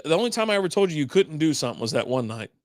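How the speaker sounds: ordinary talk, nothing marked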